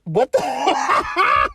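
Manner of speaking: high, loud disbelief tipping into laughter; startled amusement. Real, not acted. Friend reacting to a blunt line on seasonal friends, amazed.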